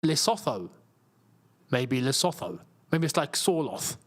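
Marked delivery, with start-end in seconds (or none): 0.1-2.6 "Lesotho" as lih-SOTH-oh, with a TH; spelling pronunciation. Shown as a demonstration. Streamer guessing from the spelling, tentative "Lesotho" with TH. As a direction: sound out the TH in "Lesotho", falling. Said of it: "saying it as it's spelled".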